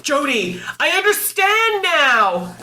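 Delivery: high, slow awed confession; converted convert. Retold or imitated. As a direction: sound like a humbled convert. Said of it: "You'll message me and be like".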